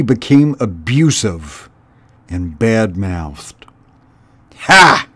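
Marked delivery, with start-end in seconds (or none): 4.6-5.1 loud, raging snarl; raging rat. A narrator's reading. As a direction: spit it at the mice in a rage. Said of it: "he raged".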